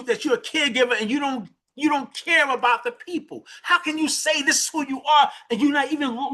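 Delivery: high, pleading retold rebuke; retold outraged scolding. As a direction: voice a tearful coworker's high indignant challenge. Retold caregiver scolding coworker.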